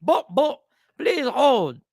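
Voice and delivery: put-on botched Jamaican accent on the hold message; a lightly done Jamaican accent gone Indian; failed Jamaican accent. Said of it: "my Jamaican sounds like an Indian".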